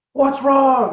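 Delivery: high, loud call from down the road; shouted question. Retold or imitated. Crew member calls out to the rider fixing a flat, shouting. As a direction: yell it up to a stranded rider. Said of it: "then I hear this".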